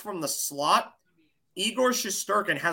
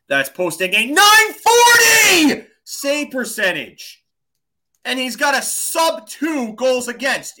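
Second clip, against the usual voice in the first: slow, much higher and louder, almost shouting; emphatic shout; 5 semitones higher, 9 dB louder than usual. Real, not acted. Cohost defends his goalie pick to the audience, shouting emphatically. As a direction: hammer the stat home like it settles the debate.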